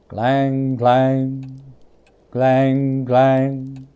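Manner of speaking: a tolling bell sound, growing by each stroke; imitated bell ringing. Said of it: "he saw this bell begin to swing".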